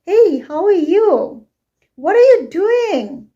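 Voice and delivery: "Hey, how are you? What are you doing?" high and loud, big swoops on YOU and DOING; rising question intonation. Shown as a demonstration. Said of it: "use our rising intonation".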